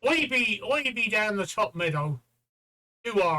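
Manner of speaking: broad, drawling Suffolk voice; slow it down into rural Suffolk; Suffolk accent. Shown as a demonstration. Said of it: "in a Suffolk accent".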